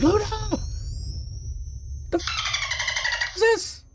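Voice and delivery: high startled shriek of surprise; panicked surprise, voice jumping way up; shocked squeal. Retold or imitated. Startled by the bidet switch.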